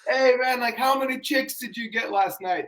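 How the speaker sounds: high-pitched mock heckling; imitated heckler. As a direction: call it out like a fan in the stands. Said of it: "everyone was yelling at him".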